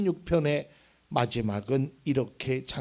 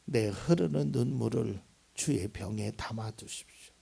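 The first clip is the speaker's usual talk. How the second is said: quiet prayer; 6 semitones lower, 5 dB quieter than usual. Acted. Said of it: "그러면서 오늘 말씀에".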